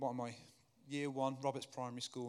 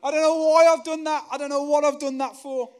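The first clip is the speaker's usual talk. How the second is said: much higher, louder hammed-up kid wail; flustered kid; 23 dB louder than usual. Stuck boy answering the laughing teacher in a mock wail. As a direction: whine it like a panicked six-year-old. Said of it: "And I'm like".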